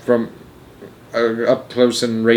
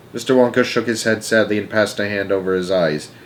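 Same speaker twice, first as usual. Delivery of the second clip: plain reading voice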